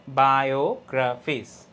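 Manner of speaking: slow biographies in parts, weight on গ্রা; stressed syllable. Shown as a demonstration. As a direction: break up biographies, leaning on গ্রা. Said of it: "এই যে গ্রাফিস".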